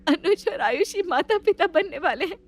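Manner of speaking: holding back tears while saying she is fine; tearful, forcing herself to sound happy; teary joy. Acted. Sister-in-law hiding her own sorrow, tearfully. Jyoti's tears at the pregnancy news.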